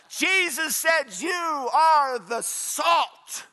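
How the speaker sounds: rousing pulpit delivery; impassioned preaching. Real, not acted. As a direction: preach it with mounting force. Preaches at full force.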